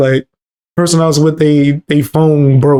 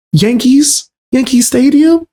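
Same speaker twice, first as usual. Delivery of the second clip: excited high voice; 11 semitones higher, slower than usual. Retold or imitated. Locals asking about the Bronx.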